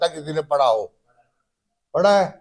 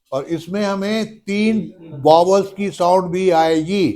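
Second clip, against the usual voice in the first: ordinary delivery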